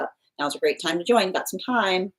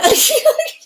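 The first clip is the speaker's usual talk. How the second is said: burst of laughter; 9 dB louder than usual. Real, not acted. Host surprised by Earth rotation news.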